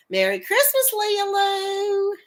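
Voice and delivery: high, drawn-out holiday call; singsong greeting. Real, not acted. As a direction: sing out the nickname, held high. Singsong holiday shout-out to lurker.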